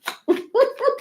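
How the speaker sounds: squealing burst of laughter; a sudden high giggle; high-pitched laugh. Real, not acted. Teasing Geminis, she breaks into a high-pitched squealing laugh.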